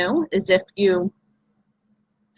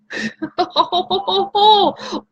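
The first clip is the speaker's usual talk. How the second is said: giggle; 10 semitones higher than usual. Real, not acted. Laughs at own joke.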